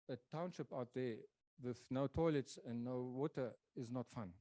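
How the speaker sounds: ordinary talk, nothing marked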